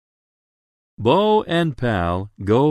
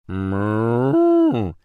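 drawn-out mooing; cow moo. A narrator's reading. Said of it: "says a cow".

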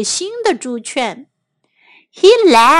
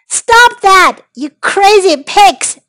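loud, high scolding shout; angry yell; 6 semitones higher, 7 dB louder than usual. A narrator's reading. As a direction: yell it at naughty pigs. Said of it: "yelled Mister Fenster".

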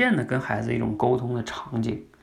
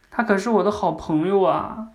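little daughter; 7 semitones higher, 4 dB louder than usual. Retold or imitated. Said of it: "女儿会说什么".

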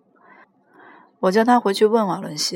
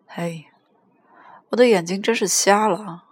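ordinary delivery

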